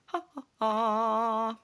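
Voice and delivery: hammed-up villain laugh; mock gloating laugh. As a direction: gloat like a smug official. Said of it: "they're gonna go".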